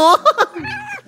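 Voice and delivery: high laugh. Real, not acted. Laughing at Huggy greeting the baby.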